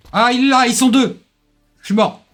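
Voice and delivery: high loud alarmed cry; panicked yelp. Real, not acted. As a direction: yelp in panic as you die. Spotted enemy, dies.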